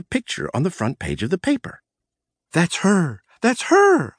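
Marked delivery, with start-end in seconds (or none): 2.5-4.2 high, louder excited cry; excited shout. Acted. Lovestruck boss spots her photo, shouting in delight. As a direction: shout it, thrilled. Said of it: "I shouted".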